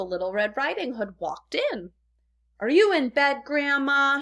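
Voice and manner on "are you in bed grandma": louder, higher call into the house. A narrator's reading. Girl calls to Grandma at the door, louder. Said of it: "she called out".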